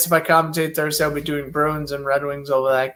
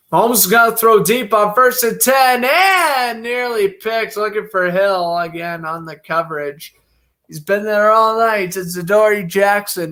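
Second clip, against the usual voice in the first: brisk broadcaster's call of each play; live play-by-play; 5 semitones higher than usual. Acted. Calling the football game live for stream viewers, play-by-play announcer voice. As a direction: call the snap and throw like a TV announcer.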